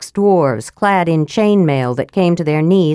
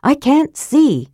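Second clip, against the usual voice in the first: higher, urgent and slowing on the last words; anxious demand; 7 semitones higher, slower than usual. A narrator's reading. Karaman blind in the dark, demanding answers anxiously. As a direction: press them for an answer in the dark. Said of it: "Karaman demanded".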